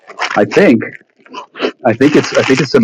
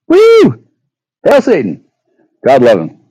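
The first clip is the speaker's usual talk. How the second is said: high, loud sudden whoop; hammed-up devilish cheer; mock cheer; 10 semitones higher, 5 dB louder, slower than usual.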